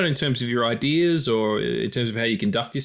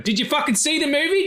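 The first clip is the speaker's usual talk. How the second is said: exasperated yell. Acted. Ranting at Scarface fans, exasperated yell. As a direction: shout the question at the fans.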